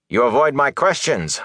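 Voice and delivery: snapped. A narrator's reading. Officer losing patience with Rand, snapping. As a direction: snap at him with rising irritation. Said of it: "Bornhold snapped".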